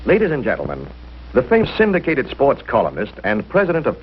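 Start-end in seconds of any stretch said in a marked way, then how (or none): none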